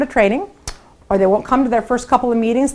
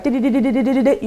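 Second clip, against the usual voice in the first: rapid di-di-di; faster than usual. Shown as a demonstration. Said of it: "you're like".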